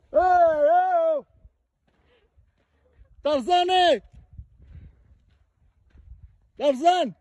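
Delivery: high, drawn-out holler; playful calling out. Pretend. Hiker hollering for Tarzan in the woods, playful calling out. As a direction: holler as if across a valley.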